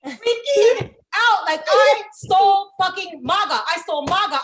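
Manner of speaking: hammed-up panic; freaking out. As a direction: shout it in panic. Panicked retelling.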